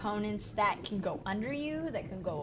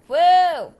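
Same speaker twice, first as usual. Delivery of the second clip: loud, startled yelp; loud surprised shout; startled whoa; 8 semitones higher, 17 dB louder than usual. Real, not acted. Sudden fall.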